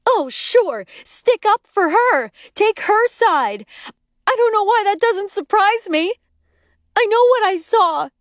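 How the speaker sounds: furious sarcasm. A narrator's reading. Screaming wife turns on her husband.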